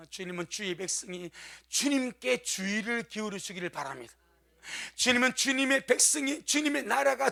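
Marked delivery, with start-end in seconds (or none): none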